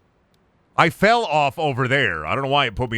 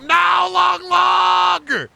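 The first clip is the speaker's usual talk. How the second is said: a long, high, drawn-out shout of despair; anguished yell; 6 dB louder, much slower than usual. Real, not acted. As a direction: wail it as the log is lost, much higher and louder. Game takes his log away.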